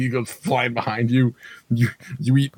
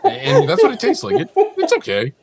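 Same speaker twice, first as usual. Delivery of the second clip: laughing; 5 dB louder than usual. Real, not acted. Trying to say how it tastes, laughing. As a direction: talk through high giggles. Talking through own laughter.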